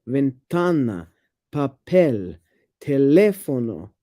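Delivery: Ventana, papel, teléfono, each slowly stressed on its second syllable. Shown as a demonstration. Demonstrating the simple rule, second syllables hit in Ventana, papel, teléfono. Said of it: "It falls on the second syllable".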